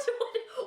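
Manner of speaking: quick high giggle; short laugh. Real, not acted. She giggles at the absurd accusation.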